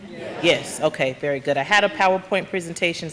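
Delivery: usual speaking voice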